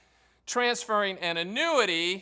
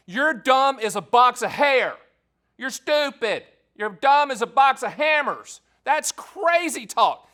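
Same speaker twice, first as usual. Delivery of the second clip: louder, higher, slow scornful jabs; hammed-up scorn for dumb advice; mock insult rant; 6 semitones higher, 8 dB louder, slower than usual. Host mocking advisors who ban annuities in IRAs, hammed-up scorn.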